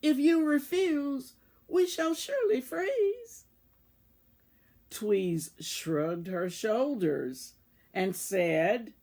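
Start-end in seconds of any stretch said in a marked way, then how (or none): 0.0-3.4 pleading cry. A narrator's reading. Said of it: "Then all of the mice cried".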